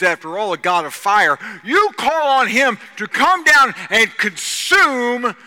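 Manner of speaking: loud, high-pitched prophet's challenge; booming pulpit oratory; full-force preaching. Acted. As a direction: throw down the challenge to a crowd.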